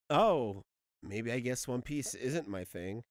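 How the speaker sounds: soft, slow, low musing to oneself; let-down reaction. Retold or imitated. Reader recalling doubts about One Piece, quietly let down. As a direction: quietly retell a deflated first reaction. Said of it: "I was just kinda like".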